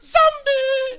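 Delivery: loud, very high zombie shriek. Pretend. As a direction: squeal it out. Variations on announcer bit.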